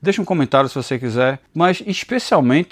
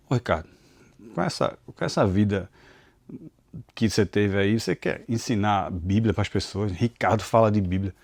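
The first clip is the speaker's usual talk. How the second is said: mock accusing inner voice; 5 semitones lower, 6 dB quieter than usual. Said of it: "Era como se eu ouvisse assim".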